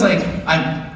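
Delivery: ordinary delivery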